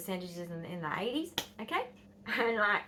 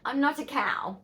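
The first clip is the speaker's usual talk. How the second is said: imitated indignant protest; 5 semitones higher, 4 dB louder than usual. Daughter protesting to mum in story.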